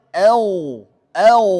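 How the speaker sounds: "Au" ending in a loud held gh; strong sustained ghain after "Au"; flowing ghain. Shown as a demonstration. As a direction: let the throaty voiced gh closing "Au" run on. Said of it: "Kemudian ghain".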